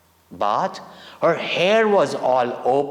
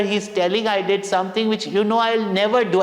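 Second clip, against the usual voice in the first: put-on higher, fast, hurt little boy pleading; lightly voiced weeping child protesting innocence; tearful child's plea; 6 semitones higher, faster than usual. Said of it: "I started weeping".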